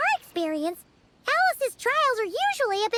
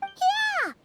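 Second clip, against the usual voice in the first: excited; 10 semitones higher than usual. Acted. Eager reply.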